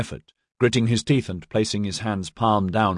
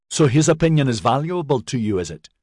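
high, heated sarcasm dropping on the tag question; indignant, pitched-up jibe; jealous challenge; 6 semitones higher, 3 dB louder than usual. A narrator's reading. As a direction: accuse him of favouritism. Maxon sneers at favouritism.

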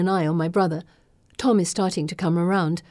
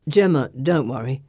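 plain reading voice

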